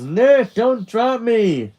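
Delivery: high, loud squeal of protest; loud, playful plea; yelling. Acted. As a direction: yell it as you are carried off. Carried Charlie yells to Nick.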